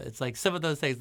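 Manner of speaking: ordinary delivery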